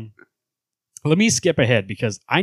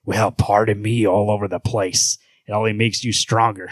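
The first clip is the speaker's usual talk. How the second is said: Batman; 4 semitones lower than usual. Acted. Hero mocking Superman, low sarcastic voice. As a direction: drop it low and mock the boast. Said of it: "low voice for Batman".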